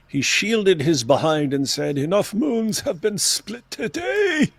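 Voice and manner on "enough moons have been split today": high, slow posh British quote; theatrical posh British line; posh British accent. Acted. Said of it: "posh British accent".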